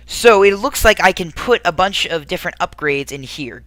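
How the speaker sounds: ordinary delivery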